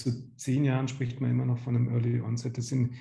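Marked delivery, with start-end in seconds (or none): none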